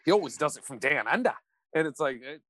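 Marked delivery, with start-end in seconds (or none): 0.8-1.3 mock Australian twang; Australian accent. Fan mocking bad Gibson impressions, hammed-up Australian accent. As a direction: do a stock Australian guy. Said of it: "me doing an Australian accent and saying".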